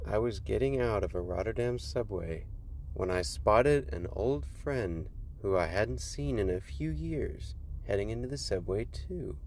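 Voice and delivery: plain reading voice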